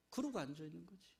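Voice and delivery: normal talking voice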